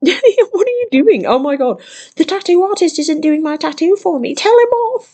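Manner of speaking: high, loud, whiny mock Karen. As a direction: shriek like a spoiled entitled customer. Mimicking Karen's whiny demand.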